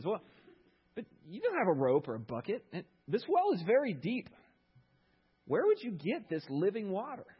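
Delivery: a hint of a doubting village woman; woman at the well.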